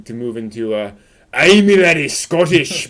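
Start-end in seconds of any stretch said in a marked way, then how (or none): 1.3-2.9 loud, over-the-top Scottish accent, drawn out; exaggerated Scottish accent, much louder. Shown as a demonstration. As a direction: belt out a hammed-up Scots burr. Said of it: "a little over the top".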